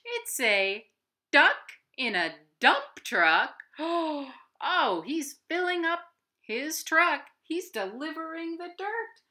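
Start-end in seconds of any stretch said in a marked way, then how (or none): none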